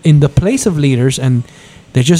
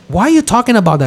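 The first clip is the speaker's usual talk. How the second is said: quick, higher mocking whine; mocking sister; 7 semitones higher, much faster than usual. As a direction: toss it back fast and high like a brat.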